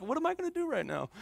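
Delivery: high, hushed, rushed mock panic; nervous panic. Preacher imagines someone freaking out mid-sermon, mock nervous panic. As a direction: act out a flustered freak-out under your breath. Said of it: "probably gonna get really nervous".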